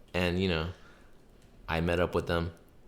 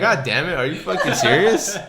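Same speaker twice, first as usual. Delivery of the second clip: higher and louder, half-laughing disbelief; mock outrage; 11 semitones higher, 12 dB louder than usual. [name] reacting to coworkers leaving, mock outraged shout. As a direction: shout it in playful shock. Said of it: "And I was like".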